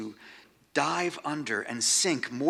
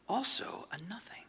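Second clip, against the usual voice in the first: breathy whisper, higher, softer; 10 semitones higher, 6 dB quieter than usual. Acted. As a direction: let the words dissolve into a whisper. Reflective reading, trailing to whisper.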